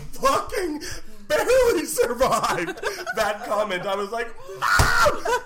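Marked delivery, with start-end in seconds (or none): 4.6-5.4 high loud protest; mock outraged shout. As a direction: yell it in disbelief. Exclaiming at mother's joke.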